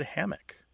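hammock as HAM-uck, weak second syllable; common pronunciation. Shown as a demonstration. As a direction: reduce the end of hammock to a short uck. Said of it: "they all".

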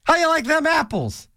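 high playful taunt How you like them apples; hammed-up cliché taunt; mock taunt. Shown as a demonstration. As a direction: toss it out like a cocky challenge. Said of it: "That's one of the worst".

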